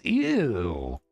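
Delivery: sharp grossed-out yelp; boy gets splashed at the urinal, disgusted yelp. Acted. Pee splashes on hands.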